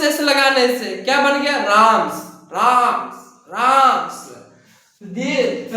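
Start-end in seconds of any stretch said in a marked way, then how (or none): 1.6-4.3 राम्स said slowly with a clear s added at the end; slow drilled pronunciation. Shown as a demonstration. Teacher drills the class on राम्स, slow and clear. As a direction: repeat राम्स, leaning on the final s. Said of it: "राम का उच्चारण बदल गया".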